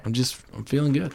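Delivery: quiet, drowsy, content; sleepy contentment. Real, not acted. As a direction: murmur it sleepily. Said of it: "sounding sleepy though".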